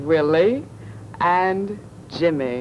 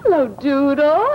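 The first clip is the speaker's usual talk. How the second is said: excited greeting; 6 semitones higher, 5 dB louder than usual. Acted. Greeting puppet with children.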